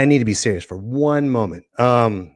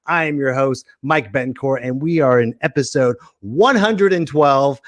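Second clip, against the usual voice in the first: ordinary talk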